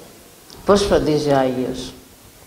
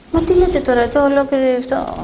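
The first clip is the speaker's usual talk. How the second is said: much higher, incredulous outcry; as if he can't believe his ears; retold priest protesting to the nun in disbelief; astonished protest; 9 semitones higher than usual.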